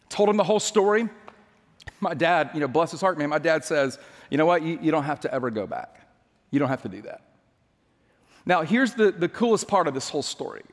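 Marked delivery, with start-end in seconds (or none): 4.3-7.2 low, softened fatherly voice; quiet imitation of a consoling dad; reassuring dad. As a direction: let him off gently, pitched down. Dad gently reassuring his upset son.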